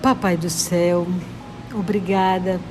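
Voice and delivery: ordinary talk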